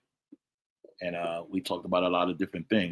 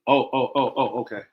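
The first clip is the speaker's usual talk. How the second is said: ordinary delivery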